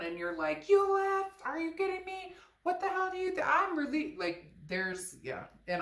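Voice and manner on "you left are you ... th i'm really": high, loud indignant outburst; outraged disbelief. Retold or imitated. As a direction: shriek it at the ex who walked out. Angry reply to returning ex.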